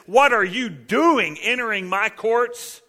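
indignant God. Retold or imitated. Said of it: "why he says".

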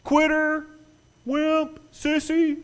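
high, loud jeering names; mocking taunts. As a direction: jeer like a schoolyard crowd. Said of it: "they all begin to mock you".

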